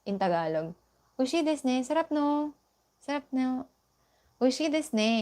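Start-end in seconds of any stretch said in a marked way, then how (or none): 1.2-5.1 example phrase. Shown as a demonstration. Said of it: "Masarap, di ba?".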